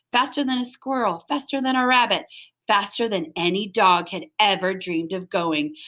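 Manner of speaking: usual speaking voice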